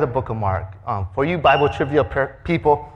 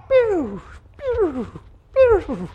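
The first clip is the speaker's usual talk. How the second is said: firework pew sounds; much slower than usual. Retold or imitated. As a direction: spit out each shot like a firework. Shots flying in all directions.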